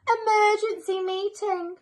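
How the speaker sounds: loud, high urgent summons to the elves; urgent call. A narrator's reading. Mister Claus summoning the elves to the grotto, urgent shout. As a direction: call everyone in at once. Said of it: "called Mister Claus".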